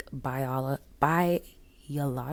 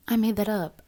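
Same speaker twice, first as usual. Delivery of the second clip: I made that up said louder and higher; louder, higher, punching I; loud playful admission; 6 semitones higher, 6 dB louder, faster than usual. Real, not acted.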